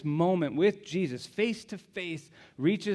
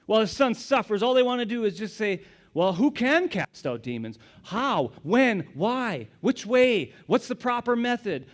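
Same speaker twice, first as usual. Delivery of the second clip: rapid, louder mock quibbling; mock the petty questions; 6 semitones higher, 6 dB louder than usual. Preacher mocking religious leaders' quibbling, louder.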